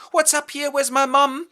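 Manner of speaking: put-on high, loud anxious girl voice. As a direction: call out nervously for mum. Agitated daughter calling for mother.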